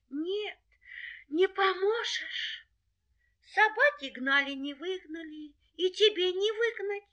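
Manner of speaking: very high, plaintive little voice; tearful doubting hare. Acted. Hare tells the helper it is useless, tearful. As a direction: lament hopelessly in a high voice.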